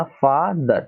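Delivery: Father in two drawn-out parts, "फा - दर"; split in two syllables. Shown as a demonstration. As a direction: show both syllables of father separately. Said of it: "आपने दो part में बोला ना".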